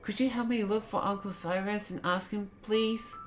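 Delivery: put-on high, light little boy voice; child character Joel. Voices young Joel's game dialogue.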